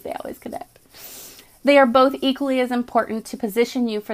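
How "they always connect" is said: unvoiced whispered echo of the line; whispered repeat. Shown as a demonstration. Reader echoes the message to viewers in a whisper. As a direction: whisper it back for emphasis.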